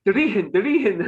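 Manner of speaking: put-on the German kids copying an Irish-accented Fertig, laughing; German girls in Irish accent. Kids in the building calling the dog in an Irish accent. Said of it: "in my shitty Irish accent".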